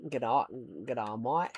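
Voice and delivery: put-on Australian mate-to-mate greeting; Australian twang, rising at the end; Australian accent. Shown as a demonstration. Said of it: "I learned it from family members who are Australian".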